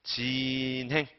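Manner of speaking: 진행 drawn out very slowly; reading demonstration. Shown as a demonstration. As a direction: stretch the first syllable of 진행 right out. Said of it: "이렇게 읽으시면 돼요". ("진행"을 길게 늘여서 읽기)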